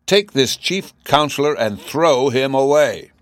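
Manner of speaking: imperious sentence from a cross king; angry command. A narrator's reading. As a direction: command it slowly and forcefully. Furious king orders his guards.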